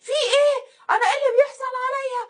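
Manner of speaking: high, loud, fast wailing; mock hysterical screaming. Wife screaming and slapping her face, mock hysterics. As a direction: imitate a wife shrieking in panic. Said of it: "وقعدت تصوت وت".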